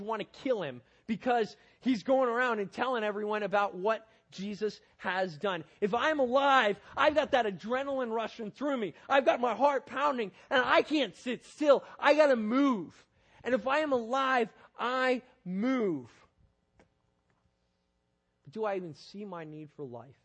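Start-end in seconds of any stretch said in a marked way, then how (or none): 5.8-16.1 loud, fired-up preaching; excited, rising energy. Acted. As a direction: build it up like your heart is pounding. Building excitement, can't sit still.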